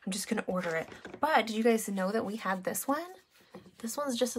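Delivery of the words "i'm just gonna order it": whispered quote. Retold or imitated. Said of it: "They're like".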